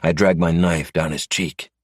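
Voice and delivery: ordinary delivery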